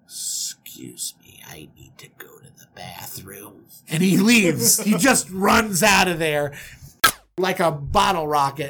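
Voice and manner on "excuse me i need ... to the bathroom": very low, slow, barely audible; stunned whisper. Acted. Groom escaping the wrong bride, stunned whisper. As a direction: excuse yourself in a numb hush. Shocked groom excuses himself.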